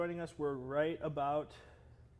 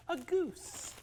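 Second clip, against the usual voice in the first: goose voice. Pretend. High squeaky goose voice.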